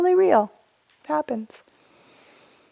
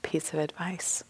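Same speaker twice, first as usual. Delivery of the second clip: a quick whispered tip; whispered aside; 4 semitones lower, 13 dB quieter than usual. Real, not acted. Teacher slipping the group a tip, in a whisper. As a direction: lean in and whisper it.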